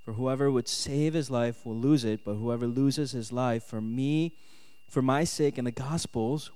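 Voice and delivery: ordinary talk, nothing marked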